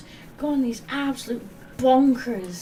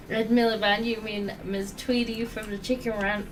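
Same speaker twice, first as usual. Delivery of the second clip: ordinary talk, nothing marked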